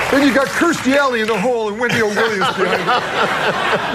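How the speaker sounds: high, giggling retelling; can barely hold the laugh; laughing through a quote. Real, not acted. Host quoting Super Dave's football question, laughing.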